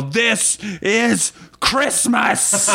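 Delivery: mock battle yell. Player headbutting the old lady, slow hammed-up yell. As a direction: roar it slowly like a war cry. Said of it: "I'm gonna yell".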